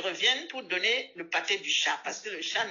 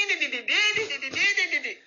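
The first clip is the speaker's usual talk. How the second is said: high di di babble; high babbling di di; mock chatter; 6 semitones higher, 3 dB louder than usual. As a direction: mimic her nonstop chatter. Imitates her chatter.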